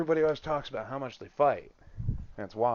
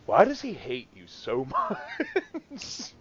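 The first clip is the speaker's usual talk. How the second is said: laughing quote; 7 semitones higher, much slower than usual. Retold or imitated. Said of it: "they're just like".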